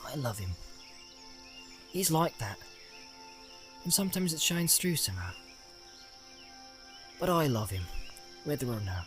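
tender murmur to oneself; hushed rustic hobbit musing. A narrator's reading. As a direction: murmur it softly, with pauses to think. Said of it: "and murmured".

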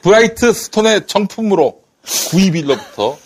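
hard sell retake. Shown as a demonstration. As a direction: restart the pitch, 브라이트 stressed. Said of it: "자, 다시".

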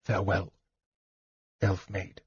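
very soft, tender farewell; softly. A narrator's reading. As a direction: say goodbye low and hushed. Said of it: "he said softly".

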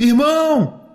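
high, drawn-out searching holler; long vowels, pitched high; calling out. A narrator's reading. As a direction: cry out for your lost brother.

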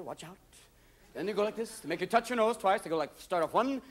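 usual speaking voice

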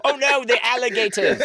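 high hammed-up panic; mock panic. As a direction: squeal it in fake terror.

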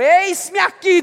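eis-me aqui shouted high and loud; fervent preached cry of eis-me aqui. Acted. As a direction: proclaim eis-me aqui to the whole church. Preacher repeating Ananias's answer loudly.

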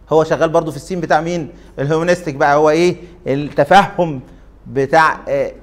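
ordinary talk

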